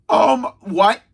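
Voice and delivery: drawn "um", then a disbelieving question; hammed-up confused double take; baffled. Third retold reaction to reveal.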